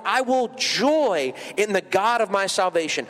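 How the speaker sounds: slow, solemn Bible reading; scripture reading. Acted. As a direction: read the verses with reverence.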